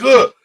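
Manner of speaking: loud, high burst of imitated startle; startled grunt. Showing how he jumps watching startle videos, startled grunt. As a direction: jolt out a mock-startled "ugh".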